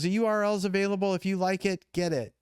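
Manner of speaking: high-pitched excited imitation; hyperventilating caller. Namer urges client to grab the web address, imitated as excited. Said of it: "my phone rings with her hyperventilating".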